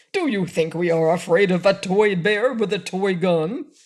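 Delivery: angry. A narrator's reading. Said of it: "asked the frogman angrily".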